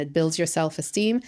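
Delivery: ordinary delivery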